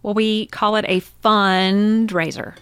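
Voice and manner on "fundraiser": "fundraiser" with a drawn-out "fun"; slow, punched "fun" in "fundraiser"; emphasis on FUN. Real, not acted. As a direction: lean on the first syllable of "fundraiser", stretching it. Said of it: "emphasis on the word fun".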